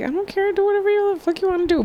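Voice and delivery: high, soft, quick brush-off; breezy indifference. Acted. Imagined reply to someone's scheme.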